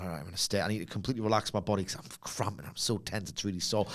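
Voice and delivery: low, soft, subdued voice; voiced relaxed client. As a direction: murmur it low like a massage client. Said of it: "And I'm like".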